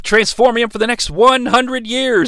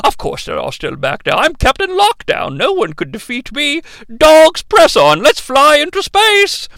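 Captain Lockdown; 4 semitones higher than usual. Retold or imitated. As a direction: play a pompous space captain. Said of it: "Captain Lockdown's like".

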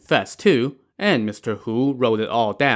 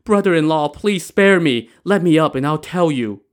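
higher, louder desperate plea; begging; 5 semitones higher, 5 dB louder than usual. Acted. Terrified widow begs brother-in-law at knifepoint, begging. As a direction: beg for mercy, stretching the plea. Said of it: "make her start begging".

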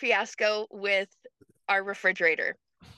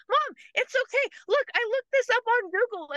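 high, quick, eager daughter voice; a lightly voiced girl hurrying to help; daughter reassuring; 13 semitones higher, faster than usual. Daughter showing mom a Google fix, high eager voice. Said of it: "she's like".

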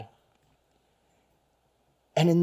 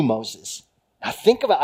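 normal talking voice

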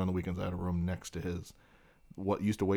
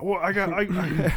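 put-on excited drunk dad; 11 semitones higher, 7 dB louder than usual. Dad voiced handing out gifts.

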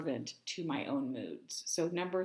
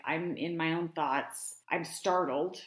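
ordinary talk

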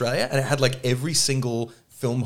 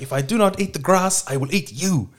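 a lightly put-on Italian accent, starting high; mock the furious director in an Italian accent; a hint of an Italian accent, half-hearted menace; 6 semitones higher than usual. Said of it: "Italian accent".

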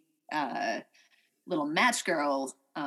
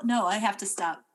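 usual speaking voice

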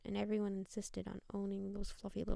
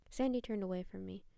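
ordinary delivery, nothing marked

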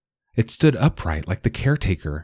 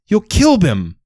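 high, louder, desperate warning; panicked cry; 13 semitones higher, 5 dB louder than usual. A narrator's reading. Rose pleading for Bim's life, panicked and loud. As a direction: stop it before he dies.

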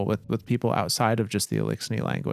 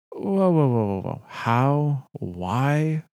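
incredulous outburst, starting higher and easing down; puzzled hunter; 4 semitones higher, slower than usual. Acted. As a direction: act out a baffled hunter stopping the story. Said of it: "The hunter frowns".

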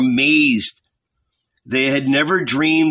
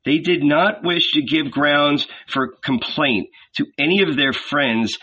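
ordinary delivery, nothing marked

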